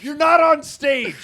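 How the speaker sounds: shout. Real, not acted. Scolding the yelling co-host.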